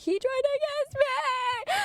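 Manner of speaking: very high, drawn-out squealed wail; hammed-up screamed complaint to friends; mock scream. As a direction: wail it in exaggerated horror, way up high. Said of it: "screaming, I was like".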